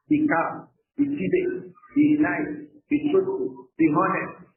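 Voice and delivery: high, loud string of commands; listing rules. Retold or imitated. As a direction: call out each rule like an old teacher. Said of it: "they will be teaching the children manners".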